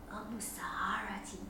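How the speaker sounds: whispered like a secret. A narrator's reading. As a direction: breathe the word as a hidden wish. Telling her secret dream.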